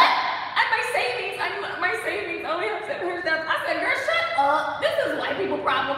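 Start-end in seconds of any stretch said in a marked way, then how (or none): 0.0-3.5 high, loud comic lament; mock crying. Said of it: "She's crying". 3.8-6.0 loud, high, laughing put-down; amused scolding. Retold or imitated. Woman teasing her wealthy friend, loud laughing scolding.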